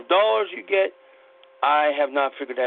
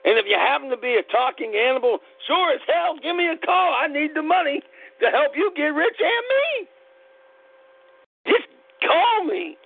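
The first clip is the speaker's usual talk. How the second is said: emotional pleading; 11 semitones higher than usual. Real, not acted. Pleading, voice rising high.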